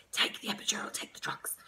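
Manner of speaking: whispered conspiratorial advice; whispered aside. Real, not acted. Mother whispering to viewers to take the drugs. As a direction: whisper it as a secret tip to viewers. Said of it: "aside advice to viewers".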